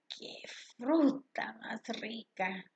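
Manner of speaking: a hushed, savoring exclamation; whispered exclamation. Shown as a demonstration. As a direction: whisper it in delight. Teacher demonstrating exclamations.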